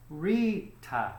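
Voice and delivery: Rita said slowly in two parts, Ri-ta; two syllables. Shown as a demonstration. Teacher counting out syllables for learners, Rita split in two. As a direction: break Rita into Ri and ta, the second dropping. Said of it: "so it would be two syllables".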